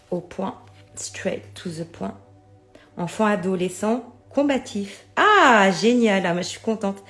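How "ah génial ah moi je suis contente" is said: delighted. Real, not acted. Delighted by a tarot card.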